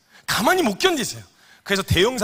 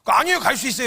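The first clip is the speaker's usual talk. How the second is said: higher, fervent vow; eager pledge; 7 semitones higher, 4 dB louder than usual. Retold or imitated. Fervent Peter vowing to follow the Lord to death. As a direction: pledge it with burning zeal.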